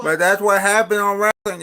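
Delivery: bursts out loud and high; blurting it out with a jolt; loud exclamation; sudden loud outburst. Real, not acted. Loud outburst about wrestling.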